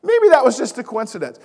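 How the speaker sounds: high, loud sarcastic exclamation; mock doubt. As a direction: hammer the skeptic's line with open irony. Mock doubt repeated for rhetorical effect.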